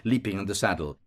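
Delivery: ordinary delivery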